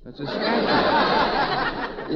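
high, slow, laughing through it. Real, not acted. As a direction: crack up as you say it. Repeats, cracking up.

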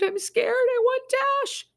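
put-on high, slow child's whimper. Little boy whimpering to his mother in fear. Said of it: "Juby whimpered".

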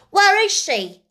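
high, loud, urgent question; almost shriek it, desperate to know; excited schoolgirl squeal; near shriek. A narrator's reading. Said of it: "almost shrieked Jessie".

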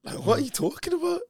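high, puzzled, mock-baffled officer; puzzled cop. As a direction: play the confused policeman, way up high. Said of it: "he's looks, like, puzzled".

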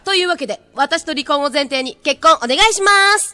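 loud pushy cheerful proposal; beaming, insistent ask; sweet eerily obsessive voice. Acted. Idol's eerie proposal.

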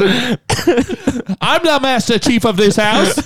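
high, very slow, laughing declaration; hammed-up pompous dad. Mocking the dad's grand claim, slow and laughing. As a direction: drag it out grandly through a laugh.

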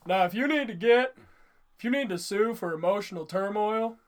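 loud put-on teacher voice, like a cartoon counselor; hammed-up gym teacher impression; Mister Lawrence. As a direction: imitate an exaggerated cartoon school counselor. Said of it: "I love doing the voice".

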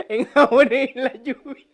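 words through laughter; amused, laughing line. Real, not acted. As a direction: laugh while you say it. Laughing at his own story.

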